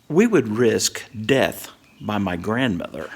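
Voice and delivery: usual speaking voice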